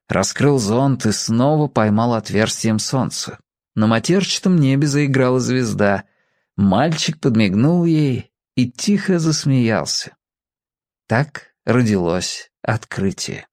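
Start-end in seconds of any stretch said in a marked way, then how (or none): none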